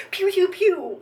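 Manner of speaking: loud high pew pew pew; finger gun sounds. Retold or imitated. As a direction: fire off mock finger guns with your mouth. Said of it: "like finger guns".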